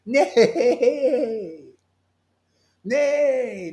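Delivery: high mock neigh of a horse. Preacher neighs like a horse at doubters. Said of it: "naysayer is like a horse".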